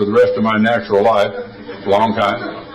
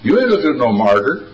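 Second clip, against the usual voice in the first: ordinary talk, nothing marked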